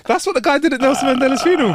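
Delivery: laughing. Real, not acted. Still laughing at the image.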